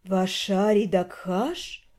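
slow, low languid drawl; sultry female voice. Acted. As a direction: drawl the name seductively. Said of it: "Медленно и томно протянула женщина".

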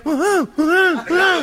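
high nasal mock barks; short imitated woofs; gangoso dog barking. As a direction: bark like a dog with a cleft palate. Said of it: "y los perros".

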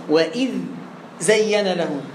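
وإذ, a short stop, then زين له; breathless-pause separation. Shown as a demonstration. Teacher questioning a student's halt between words, pausing after dhal. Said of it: "السكت".

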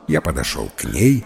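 ordinary delivery

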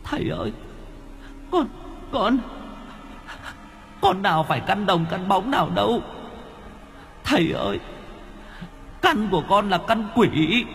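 put-on high, soft whimpering girl's voice; tearful confession. Yên confesses to the master, high and tearful. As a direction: sob out her sins. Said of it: "khóc lóc... mếu máo".

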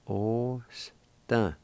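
Osta said slowly, stress on O, falling; careful demo. Shown as a demonstration. Teacher modelling Osta, careful demo. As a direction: pronounce Osta clearly, OH-stah. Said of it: "First part is pronounced like this".

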